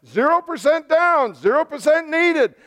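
high, mounting mock advertiser voice; TV and radio pitch. Radio advertiser pushing deals on listeners, mock hard sell. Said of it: "T V and the radio are just espousing".

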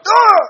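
sudden very high, loud cry of ah; sharp loud yelp. Acted. Preacher acting out the Lord teeing you up, loud yelp. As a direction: let out a startled yelp. Mock fit after technical.

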